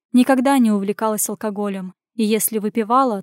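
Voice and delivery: ordinary delivery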